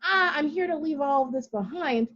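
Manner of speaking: ordinary delivery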